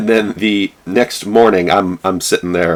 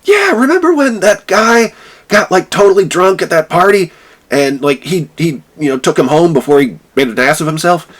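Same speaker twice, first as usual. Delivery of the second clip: hammed-up high gossip; gushing vice president; 10 semitones higher than usual. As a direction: recall the drunk party eagerly. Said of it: "vice president's like".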